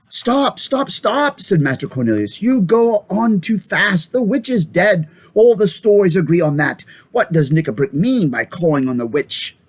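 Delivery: high, pressing voice; high urgent plea. Acted. Old doctor breaks up the quarrel, high and pressing. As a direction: stop the fight before swords come out.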